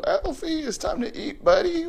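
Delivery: very high, excited announcement; excited call. Real, not acted. Owner telling the excited parrot it is time to eat, very high. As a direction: announce dinner like a party.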